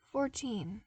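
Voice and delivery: plain reading voice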